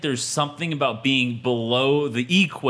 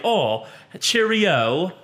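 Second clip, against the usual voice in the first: drawn-out, higher mock English accent; classy English accent; 5 semitones higher, much slower than usual. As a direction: put on a classy English "cheerio". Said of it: "to a classy English accent".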